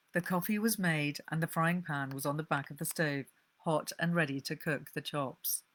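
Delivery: ordinary delivery, nothing marked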